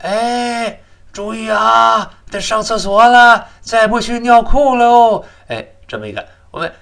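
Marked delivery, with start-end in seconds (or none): 0.1-5.2 very high, loud, drawn-out calling; hammed-up voice of nature calling from inside; inner body voice. The body calls its owner to the toilet, high put-on warning. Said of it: "身体里面有个声音在叫你的".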